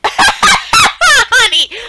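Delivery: high, loud, squealed honey; delighted cry. Real, not acted. Streamer squealing honey at a turtle, high and loud. Fond outburst at character.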